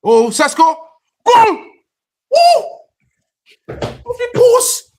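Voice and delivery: very high, very loud screamed match call; excited screaming. Real, not acted. Watcher seeing Sesko hit the post, screaming excitedly. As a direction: scream it at the top of your range.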